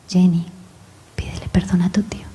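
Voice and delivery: soft, fading whisper; God's whispered voice. Retold or imitated. Dios insiste en voz baja a Jenny, en susurro. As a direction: hush it like an inner voice. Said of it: "otra vez la voz".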